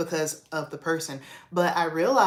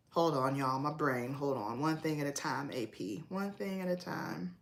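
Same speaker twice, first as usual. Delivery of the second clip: softer aside while regrouping; muttering to herself; 3 semitones lower, 8 dB quieter than usual. Real, not acted. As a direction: drop to a soft mutter, collecting your thoughts. Stops to collect her thoughts.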